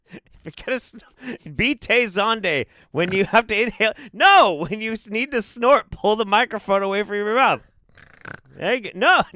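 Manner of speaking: high voice through laughter; laughing. Real, not acted. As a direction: talk while giggling.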